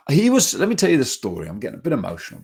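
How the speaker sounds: usual speaking voice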